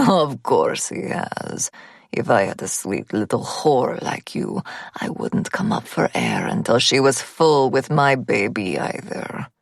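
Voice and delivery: put-on slow, low leering Russian accent; lecherous gunman in a Russian accent. A narrator's reading. Gunman leers at narrator.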